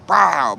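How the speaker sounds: loud, high "Pow!" imitation; punch sound. Acting out her hitting him, loud punch sound. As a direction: punch it out as the blow lands.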